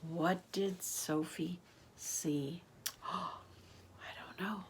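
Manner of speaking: soft, slow, whispery; hushed wondering. Acted. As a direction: wonder aloud under your breath. Hushed question between two books.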